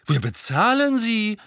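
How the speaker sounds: outraged, defending yourself; indignant. A narrator's reading. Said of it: "sagte Fred entrüstet".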